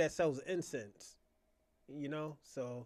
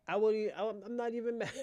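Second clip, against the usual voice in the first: higher voice breaking into a laugh; 5 semitones higher than usual. Real, not acted. Teasing Kwame he isn't mad at, laughing. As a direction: try to get it out while laughing. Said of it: "I'm sorry, I'm laughing at you".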